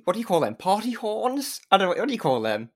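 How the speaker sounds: ordinary talk, nothing marked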